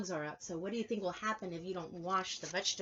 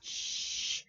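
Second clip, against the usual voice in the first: steam hiss sound. Acted. Imitating steam from the pot.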